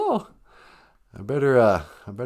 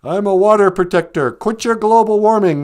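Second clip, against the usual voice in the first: mock protest shout; 8 semitones higher, 6 dB louder than usual.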